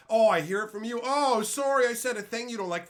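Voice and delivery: high, loud sarcastic mock; hammed-up mock apology. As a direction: sneer a fake sorry. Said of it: "what do you think?".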